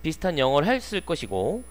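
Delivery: ordinary talk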